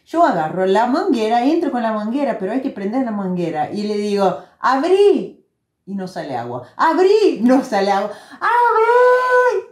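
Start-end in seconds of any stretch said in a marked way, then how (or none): 4.6-5.4 raised, higher-pitched retold call; llamado imitado. Retold calling to turn on hose. 6.8-7.4 higher, insistent retold call; llamado insistente imitado. Retold second call, no water. 8.4-9.7 very high, drawn-out retold shout; grito desesperado imitado. Retold desperate call, not heard.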